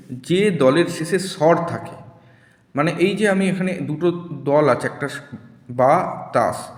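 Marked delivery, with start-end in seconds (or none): 5.7-6.7 বা তাস slowly, each syllable set apart. Shown as a demonstration. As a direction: separate বা from তাস with a small gap. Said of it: "এইটা হচ্ছে এক একটা দল".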